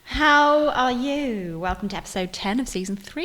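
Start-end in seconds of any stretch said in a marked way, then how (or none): 0.0-1.6 slow, stretched hello; drawn-out host greeting. Acted. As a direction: drag out the greeting.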